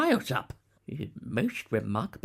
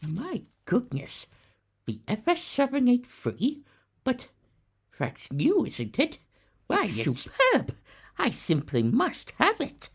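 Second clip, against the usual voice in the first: higher, gushing with delight; breathless excitement, higher; thrilled collector; 10 semitones higher, 3 dB louder than usual. Acted. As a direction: marvel at it like a child with a toy. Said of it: "could hardly control his excitement".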